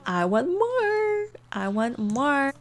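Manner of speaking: mock needy whine, slow; drawn-out greedy. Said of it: "He's literally like".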